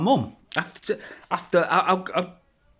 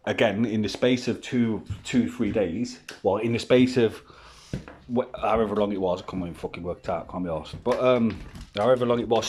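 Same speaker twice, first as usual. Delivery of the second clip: usual speaking voice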